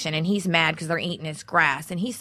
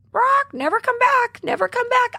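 parrot voice; 4 dB louder than usual. Retold or imitated. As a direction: squawk it out like a macaw. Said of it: "the bird goes".

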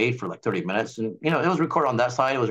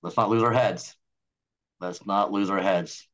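lower, soothing lawyer voice, settling the room; calm attorney; 4 semitones lower than usual. Pretend. Pfizer attorney calming panicked Moderna staff, lower and soothing. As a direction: talk them down like a steady attorney. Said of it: "the attorney says".

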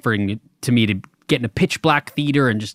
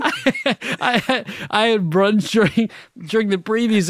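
high laughing voice; 9 semitones higher than usual. Real, not acted. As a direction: laugh through the words. Still laughing while explaining.

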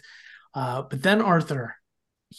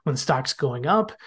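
ordinary delivery

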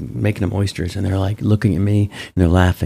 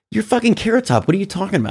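much higher, stunned disbelief at his friend; can't believe what he's hearing, voice jumping way up; incredulous outburst; 12 semitones higher, faster than usual. Retold or imitated. Best friend scolding the oyster-shucking comic, incredulous and much higher.

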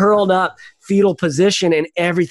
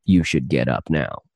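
much lower calm inner voice with a laugh; 12 semitones lower than usual. Retold or imitated. Recalling the voice in his head, low and laughing. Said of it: "my own voice kinda in my head just going like".

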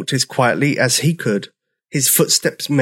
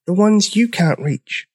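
high little dinosaur voice; a small, high-pitched promise; tiny timid voice; 9 semitones higher than usual. A narrator's reading. Newcomer reassuring the others, tiny timid voice. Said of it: "he whispered".

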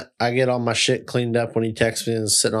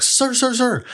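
high-pitched pleading calls, much higher; mock frantic calling; 13 semitones higher, slower than usual. Guy chasing him down calling out in a high frantic voice. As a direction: squeak out an urgent sir sir sir.

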